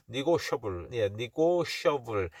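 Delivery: "니고셔블" with ni- first and a short sh-uh-bul ending; correct pronunciation. Shown as a demonstration. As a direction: model the corrected "니고셔블" for learners. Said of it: "네고시에이블이 아닙니다". ("니고셔블"로 '셔'를 한 음절로 바르게 발음)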